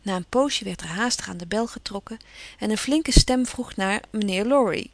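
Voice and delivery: ordinary delivery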